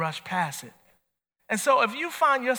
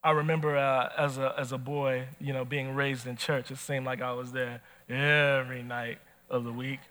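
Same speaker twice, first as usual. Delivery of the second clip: ordinary talk, nothing marked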